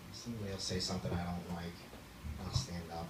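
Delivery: usual speaking voice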